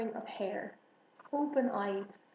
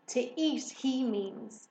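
upset cry, a touch louder; close to tears; 3 semitones higher, 5 dB louder, slower than usual. A narrator's reading. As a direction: protest with mild, acted upset. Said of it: "cried Harriet, close to tears".

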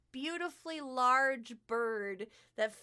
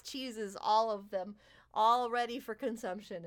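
ordinary talk

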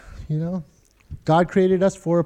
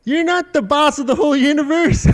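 put-on high teasing kid voice; sing-song it like a cheeky four-year-old; a giggling nephew teasing his uncle; mock child taunt; 7 semitones higher than usual. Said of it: "He goes".

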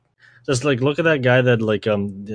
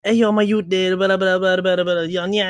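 mock kid slang; 8 semitones higher than usual. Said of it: "ten-year-old talking like".

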